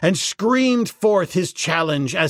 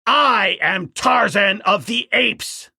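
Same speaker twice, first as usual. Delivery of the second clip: slow, loud and high challenge; Tarzan screaming; 6 semitones higher, 4 dB louder than usual. Acted. Ape man holding the king ape, slow shouted challenge. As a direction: bellow his name at the foe. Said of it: "screamed the ape man".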